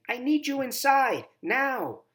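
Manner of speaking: high, loud demand; firm call to come in now; grandma ordering the girl inside; insistent call. A narrator's reading. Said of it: "Grandma says again".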